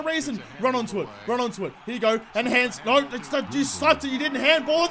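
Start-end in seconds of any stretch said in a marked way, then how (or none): none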